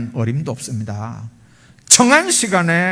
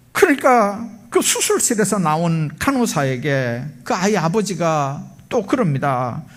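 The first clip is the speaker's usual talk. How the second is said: ordinary talk, nothing marked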